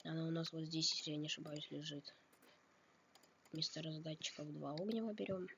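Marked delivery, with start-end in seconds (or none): none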